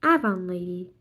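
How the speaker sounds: ordinary delivery, nothing marked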